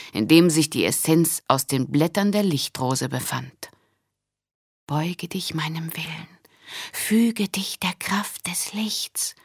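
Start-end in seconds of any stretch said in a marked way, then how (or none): 4.9-9.3 slow, solemn whispered spell; conjuring whisper, slow and intent; whispered incantation. A narrator's reading. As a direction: whisper a command to a magic bottle. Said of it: "beschwor sie es".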